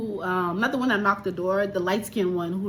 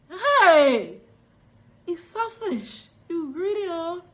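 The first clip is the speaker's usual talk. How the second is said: scolding; 6 semitones higher, slower than usual. Real, not acted. Scolding character.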